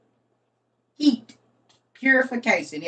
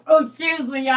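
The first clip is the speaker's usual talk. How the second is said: normal talking voice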